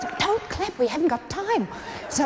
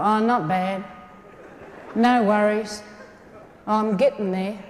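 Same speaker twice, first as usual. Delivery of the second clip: low, slow, unenthusiastic reply; mock a half-hearted "surviving" answer; low-key Aussie gloom; glum understatement; 4 semitones lower than usual. Acting glum Australian replies.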